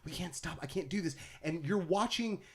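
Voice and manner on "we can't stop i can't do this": soft, panicky whimper; panicked quiet plea. Retold or imitated. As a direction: plead in a scared hush. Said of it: "he's like".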